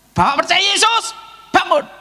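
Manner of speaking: loud, high-pitched order; acted forceful faith-healer's cry; healing command. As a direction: shout faith at the patient. Acts out healing command to patient.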